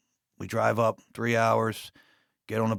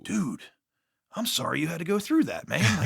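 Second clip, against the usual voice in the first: higher, half-joking condolence; mock sympathy; 7 semitones higher than usual. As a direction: pretend to pity the retired guard.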